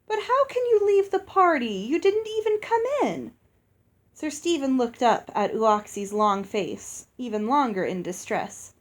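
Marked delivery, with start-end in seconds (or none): 0.1-3.3 high, plaintive giantess protest; pleading giantess. Acted. Giantess Uoxi begging the knight to stay, high and pleading. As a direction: plead like a hurt giant hostess.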